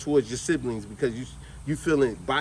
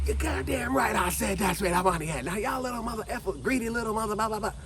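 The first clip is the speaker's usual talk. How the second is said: higher, mock-furious aunt cussing; imitated rant; 5 semitones higher than usual. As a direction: rattle off her scolding in a hammed-up voice. Aunt's retold angry rant.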